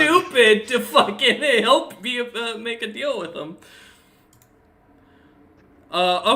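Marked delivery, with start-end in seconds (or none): 0.0-3.6 loud and high, tickled; amused outburst. Real, not acted. Game master teases that they're too stupid for a deal, amused. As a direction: raise the voice in amusement.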